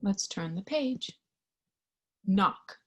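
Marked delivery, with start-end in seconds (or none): none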